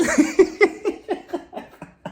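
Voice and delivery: high laughter rising at the end. Real, not acted. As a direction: crack up at her. Laughing after Mia's anger.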